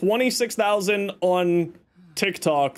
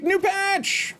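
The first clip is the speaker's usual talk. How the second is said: excited; 14 semitones higher than usual. Real, not acted. Announcing next week's patch.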